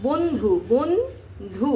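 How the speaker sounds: "বন্ধু" very slowly as bon-dhu; broken into syllables. Shown as a demonstration. Teacher showing how to split the word, very slow syllabified "বন্ধু". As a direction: draw "বন্ধু" out in two parts, bon, dhu. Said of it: "এভাবে ভাঙতে হবে".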